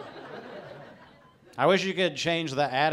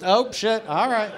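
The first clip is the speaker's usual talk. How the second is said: high, loud startled yelp; 9 semitones higher, 7 dB louder than usual. Acted. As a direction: jolt awake in panic. Startled by rumble strip.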